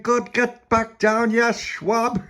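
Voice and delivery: high, drawn-out shouted order; captain's order. A narrator's reading. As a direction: holler up at the lookout.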